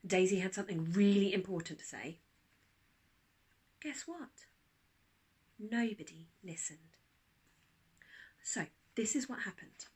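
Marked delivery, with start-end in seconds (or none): none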